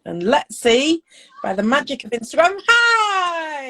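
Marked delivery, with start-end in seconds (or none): 2.7-3.7 bright, high call of hello; excited greeting. Real, not acted. Greeting guest joining the live.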